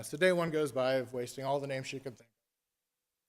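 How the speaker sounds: plain reading voice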